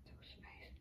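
soft unvoiced whisper. Real, not acted. Owner whispering about the dog's sleepy eyes. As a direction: breathe it, barely voiced.

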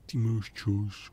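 ty máš čas stretched slowly and softly; slow down and reassure the unit; drawn-out ty máš čas, easy and unhurried. Real, not acted. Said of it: "Ty máš ještě čas na".

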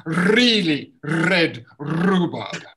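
slow tongue twister drill; slow clear really red rhubarb. Shown as a demonstration. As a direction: stretch it word by word. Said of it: "I want you to say".

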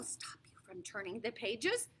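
unvoiced scheming whisper. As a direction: whisper the plan. Grover's secret plan.

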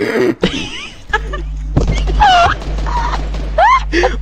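wheezing laugh. Real, not acted. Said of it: "This kid's still wheezing".

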